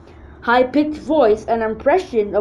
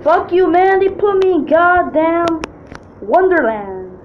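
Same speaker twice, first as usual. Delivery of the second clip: angry outburst; 7 semitones higher than usual. Acted. Kid cursing angrily at the teachers. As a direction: snap back in outrage. Character angry at being punished.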